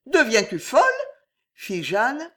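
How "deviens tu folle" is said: high, loud anxious retort; uneasy indignant lady. Acted. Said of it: "son inquiétude grandir".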